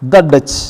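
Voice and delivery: "the" said short as "dha" before "Dutch"; "the" as "thuh". Shown as a demonstration. Teacher showing students "the" before a consonant, short "dha". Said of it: "അതുകൊണ്ട് ദ".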